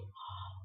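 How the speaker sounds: soft breathy gasp of wonder. Retold or imitated. Straining to see a distant figure, soft awed gasp. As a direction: draw in a quiet, awed breath.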